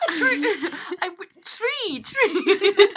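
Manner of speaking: high-pitched Irish accent through giggles; badly faked Irish accent; attempted Irish accent. Shown as a demonstration. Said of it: "do my Irish accent".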